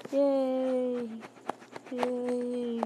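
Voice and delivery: ordinary talk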